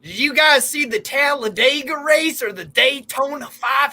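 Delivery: high Southern drawl, stretched at the end; mock American accent. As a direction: play a hammed-up American racing fan accent. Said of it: "do an American accent then".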